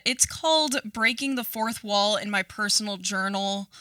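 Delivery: ordinary talk, nothing marked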